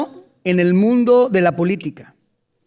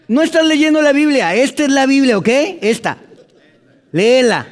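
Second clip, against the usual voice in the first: emphatic preaching; 6 semitones higher, 5 dB louder than usual. Real, not acted. Predicador insiste en leer la Biblia.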